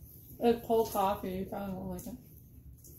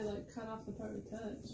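ordinary talk

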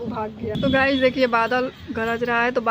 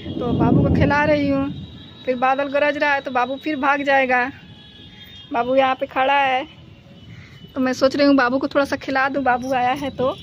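normal talking voice

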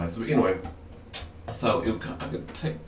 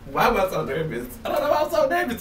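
laughing it out, much higher at the end; real jittery laugh while asking herself why; nervous laughter; 12 semitones higher, faster than usual. Real, not acted. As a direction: giggle through the nerves. Laughing at her own nerves.

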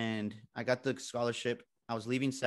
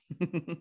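short, higher chuckle; nervous laugh; 6 semitones higher than usual. Real, not acted. Speaker scared of the audience, short nervous laugh. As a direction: chuckle briefly after owning up to nerves.

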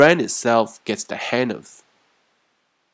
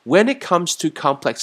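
when it comes to complex functions said higher and louder; raised teacher demo; pitched up; 7 semitones higher, 4 dB louder than usual. Shown as a demonstration. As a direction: lift the phrase up. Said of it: "那么有点高啊".

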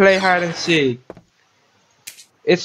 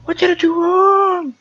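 terrified screams; 11 semitones higher than usual. Real, not acted. Gamer caught by the ghost, screaming in panic. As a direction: scream like you have just been caught.

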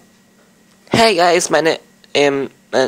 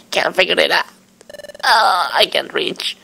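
mildly higher puzzled character voice; lightly voiced small Pokemon; 4 semitones higher than usual. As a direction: struggle aloud like a little critter. Reading game dialogue in voices.

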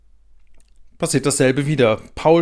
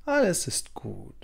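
soft hushing whisper; grandma calming her granddaughter; soothing whisper; 11 dB quieter, much slower than usual. A narrator's reading. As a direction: comfort a crying child in a whisper. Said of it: "Sie beruhigt sie".

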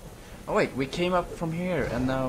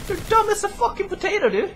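fast, much higher, louder insult; exasperated yell; 3 dB louder, faster than usual. Real, not acted. Scolding a teammate for being dumb, fast high yell.

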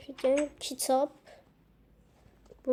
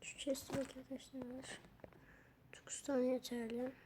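whisper; 12 dB quieter than usual. Real, not acted. Counting items to self.

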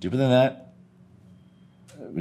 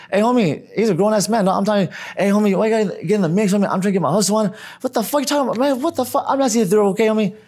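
street brother; 9 semitones higher, faster than usual. Retold or imitated. Brother telling the stranger to back off, high pushy voice. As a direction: play the hustler brushing him off. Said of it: "And this brother".

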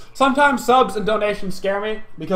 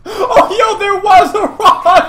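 high squealing laugh through words; 14 semitones higher, 8 dB louder, much slower than usual. Real, not acted. Enemy disappears, laughing.

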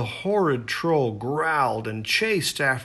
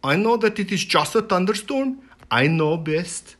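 gruff chief, louder, dismissive; stubborn chieftain; 6 semitones higher, 5 dB louder than usual. A narrator's reading. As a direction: brush off the girl as the know-it-all chief. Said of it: "the chieftain replied".

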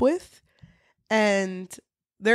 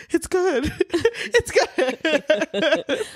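high, laughing fake praise; forced politeness; 7 semitones higher, much slower than usual. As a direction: pretend you like the food. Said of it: "I was like".

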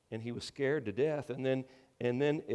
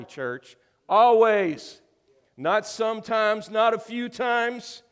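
raised, loud pulpit proclamation; full-force preaching; 8 semitones higher, 10 dB louder than usual. Real, not acted. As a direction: drive it home to the congregation, loud and high.

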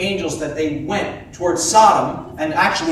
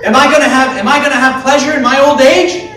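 higher, louder, laughing through the words; laughing Sarah; 7 semitones higher, 7 dB louder, faster than usual. Acted. Preacher voices Sarah laughing at the promise, higher and louder. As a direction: let Sarah laugh in disbelief. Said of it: "She laughs".

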